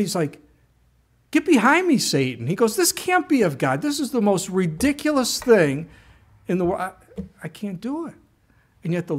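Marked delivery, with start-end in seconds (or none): 1.3-2.5 high, loud burst, dropping on the last word; alarmed rebuke. Acted. Young man rebuking voice he hears. 2.8-8.2 high-pitched, incredulous objection; acted protest. Young man protesting God's command.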